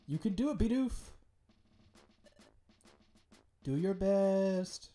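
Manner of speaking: high, slow, drawn-out cheer; cheering character voice. Acted. Streamer voicing a cheering guild member, high and slow. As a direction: cheer the hero on, lifting high and stretching it. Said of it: "I can't do many voices".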